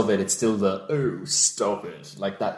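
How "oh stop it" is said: lightly camp, drawn-out scolding; slow, a hint of drag flamboyance; flamboyant. Likening villain to drag character, slow flamboyant put-on voice. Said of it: "that flamboyancy and that".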